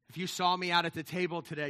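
ordinary talk

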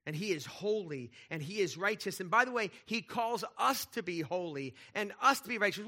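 usual speaking voice

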